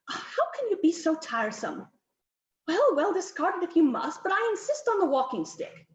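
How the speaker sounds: high-pitched exasperated Countess. A narrator's reading. Countess argues with Darcy over the portrait props, exasperated. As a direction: give in grudgingly, then insist, pitched high.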